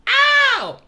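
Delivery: very high, loud comic "ow"; shrieked cartoon cry of pain; mock pained yelp. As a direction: yelp as if shot, hammed up. Acts out the victim being hit.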